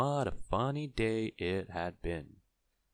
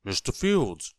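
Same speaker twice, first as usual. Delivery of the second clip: higher, louder call to the farmer; raised, eager messenger voice; eager announcement; 4 semitones higher, 6 dB louder than usual. A narrator's reading. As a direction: hail him with news to deliver. Forgetful man addresses the farmer.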